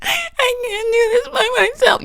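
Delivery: high, cracking plea close to crying; high teary plea to grandma; near tears. Retold or imitated. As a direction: beg with the voice breaking. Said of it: "I was almost about to cry".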